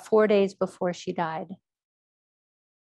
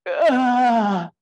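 a much louder, higher, wrenching groan; gut-wrenching groan; 4 semitones higher, 10 dB louder than usual. Retold or imitated. Mother letting go in the bed, gut-wrenching groan. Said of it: "let loose just this gut-wrenching".

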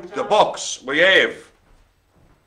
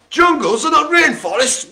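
loud announcement; 10 semitones higher, 7 dB louder than usual. Acted. Reading out a fact loudly.

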